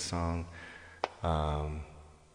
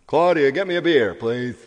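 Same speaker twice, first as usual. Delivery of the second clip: imitating husband; 7 dB quieter than usual. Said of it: "Is that how he talks?".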